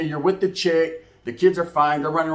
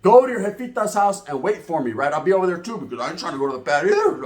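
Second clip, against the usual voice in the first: ordinary talk, nothing marked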